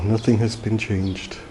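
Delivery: ordinary delivery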